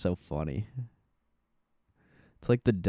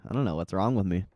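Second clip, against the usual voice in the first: ordinary talk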